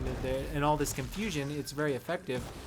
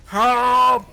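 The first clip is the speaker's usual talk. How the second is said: mock yell for help, louder and higher with each cry; enemy calling for help, louder each time; panicked cries for help; 7 semitones higher, 12 dB louder, much slower than usual. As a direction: shriek for help as if ambushed. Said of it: "They're like".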